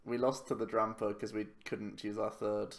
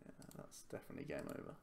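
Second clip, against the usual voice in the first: quick unvoiced mutter; 5 semitones higher, 11 dB quieter, faster than usual. Real, not acted. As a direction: whisper it under your breath. Muttered aside after bad hand.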